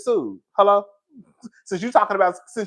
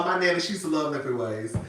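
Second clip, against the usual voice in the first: ordinary talk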